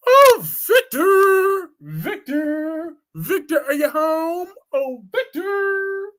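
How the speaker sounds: high, drawn-out falsetto calls of Victor; mock Nikki calling. Mocking Nikki waking her husband Victor, high sing-song calls. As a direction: sing out his name like a clingy wife.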